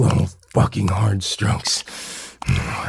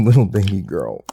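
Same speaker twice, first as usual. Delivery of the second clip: ordinary delivery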